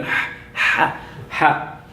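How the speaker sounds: soft breathy "Ha, ha, ha." like a gasp after hot jalapeno; breathy throat ha. Shown as a demonstration. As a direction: push the "ha" out from the throat as if the mouth burns. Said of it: "Lots of jalapeno".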